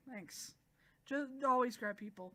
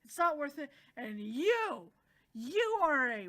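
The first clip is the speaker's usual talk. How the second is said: mock angry scolding, higher and building louder; retold yelling; 4 semitones higher, 6 dB louder than usual. She yells at the water dragon, mock angry. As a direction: scold him, rising to a yell on YOU. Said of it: "then she starts yelling".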